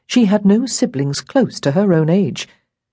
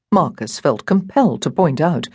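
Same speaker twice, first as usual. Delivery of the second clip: usual speaking voice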